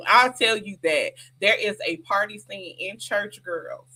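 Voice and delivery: ordinary talk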